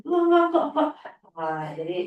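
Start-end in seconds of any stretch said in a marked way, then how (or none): 0.0-0.9 put-on much higher, louder childish no-no-no; child's refusal. Teacher imitating the boy refusing his recitation, high loud whine. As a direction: whine no like a stubborn kid.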